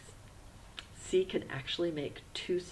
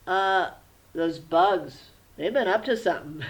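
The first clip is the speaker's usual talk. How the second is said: put-on much louder, eager little-boy puppet voice; loud, eager kid puppet; puppet Charlie voice; 3 semitones higher, 8 dB louder than usual. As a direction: play the class puppet thanking his teacher. Puppet tells teacher about bugs.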